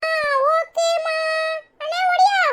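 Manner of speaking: put-on high, childlike chatter of a curious little kid; little kid asking about the baby; child voice. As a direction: play a small child pestering the family. Child calls brother.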